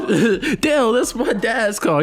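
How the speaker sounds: high, loud imitation of a panicked objection; mock alarmed protest. Friend acting out the car scene, mock alarm. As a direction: cry it out high, playing the scene.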